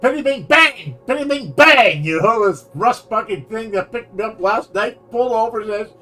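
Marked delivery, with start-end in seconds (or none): 0.0-2.0 loud, high excited announcement; excited exclamation. Retold or imitated. Storyteller excitedly announcing the rust bucket car returns, loud and high. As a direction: announce it like a game-show reveal.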